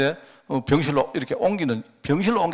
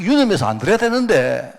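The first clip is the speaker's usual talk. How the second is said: ordinary delivery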